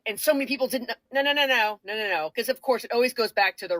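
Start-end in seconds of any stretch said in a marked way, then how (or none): none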